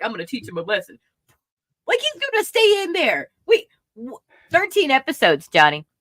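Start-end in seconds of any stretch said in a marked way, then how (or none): 0.0-1.0 lower, softer gruff vow; a hint of growly Batman; stern Batman. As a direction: play a grim hero quietly. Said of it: "Batman's like".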